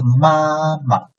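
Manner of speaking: mama held high, then short and light; first plus neutral tone. Shown as a demonstration. Teacher explains the first example, mama with light neutral ending. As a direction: stretch the first ma of mama level, clip the second. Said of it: "this neutral tone is after a first tone syllable".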